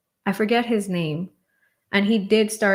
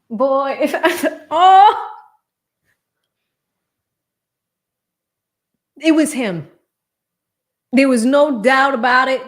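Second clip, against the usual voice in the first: excited outburst; 6 semitones higher, 7 dB louder than usual. Real, not acted. Outraged at chat defenders.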